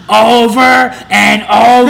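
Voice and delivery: loud, drawn-out high heckler yell; drunk heckler. Retold or imitated. Drunk heckler interrupting a comic's joke, mock drunken holler. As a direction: imitate a drunk screaming over the act. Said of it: "this dude was drunk screaming".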